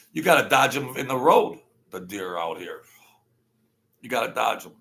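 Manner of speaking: ordinary talk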